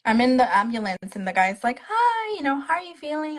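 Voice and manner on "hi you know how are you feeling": paramedic voice. Retold or imitated. Paramedic voiced in her story.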